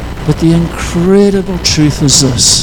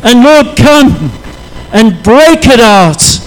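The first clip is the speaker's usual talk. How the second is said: lifted, louder praying voice, rising to God; fervent prayer; 2 semitones higher, 3 dB louder than usual. Acted. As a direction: pray it out with fervour. Prays aloud in sermon.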